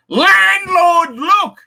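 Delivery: high, loud and slow, half-crying; drawn-out, loud, gleeful cry; excited shout. Real, not acted. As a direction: belt out the landlord look line in delight. Exclaims about bracelet.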